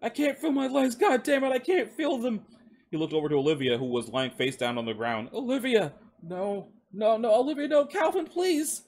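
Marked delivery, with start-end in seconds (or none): 0.0-2.5 high, strained agonized wailing; desperate high-pitched yelling; pained panic. A narrator's reading. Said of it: "he mumbled through clenched teeth". 5.3-8.8 high, distraught cries; desperate high-pitched plea; anguished pleading. A narrator's reading. Wounded man sees fallen friend.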